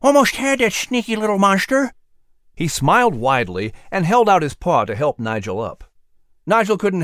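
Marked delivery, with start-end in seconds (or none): 0.0-1.9 whistling squirrel voice. Acted.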